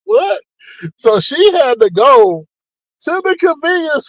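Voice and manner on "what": high, rising what; a sudden startled what; shocked what. Retold or imitated. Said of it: "I was like".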